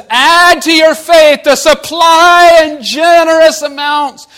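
slow, loud, high preacher boom; booming proclamation. Acted. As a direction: proclaim it to the rafters.

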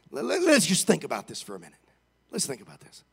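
ordinary talk

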